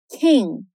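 King in American English, no extra vowel; English King, barely voiced G; closed final G. Shown as a demonstration. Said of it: "Então, perceba".

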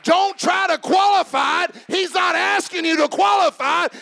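loud and high, hammering qualify; shouted exhortation. Acted. Preacher warning the church, loud shout. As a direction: shout it at the congregation.

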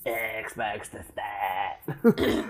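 hammed-up creepy dying imitation; horror-movie dying. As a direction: stretch it out like a dying victim's last words. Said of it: "how you would say it when you're in a horror movie dying".

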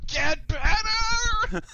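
slow shout. Acted. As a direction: stretch out a comic yell. Mock guard yelling at patient.

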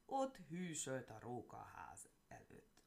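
usual speaking voice

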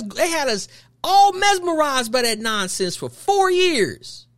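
normal talking voice

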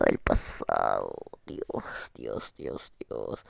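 low breathy whisper of pity. Pretend. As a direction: sigh over her younger self, whispering. Pities her past self.

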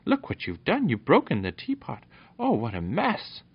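high-pitched, dismayed outcry; astonished cry. A narrator's reading. Fenella scolds the dog over the broken teapot, astonished. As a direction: exclaim in astonishment, pitch jumping up. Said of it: "cried Fe-, Fenella in astonishment".

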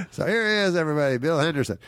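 high, quickening announcer call; retold host introduction. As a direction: announce the guest to the room.

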